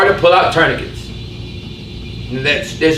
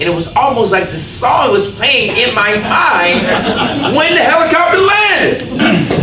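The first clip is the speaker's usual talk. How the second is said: laughing; 7 semitones higher, slower than usual. Real, not acted. Laughing at memory.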